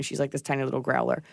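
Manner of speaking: ordinary talk, nothing marked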